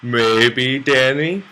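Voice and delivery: put-on a light British accent on the name, rising at the end; mock British accent calling out a viewer. Streamer greeting a viewer in a light British accent. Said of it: "imitate a British accent".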